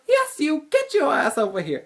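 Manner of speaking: high, loud bossy command; imitated yell. Hall monitor ordering the student over, loud high yell. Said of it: "And she goes".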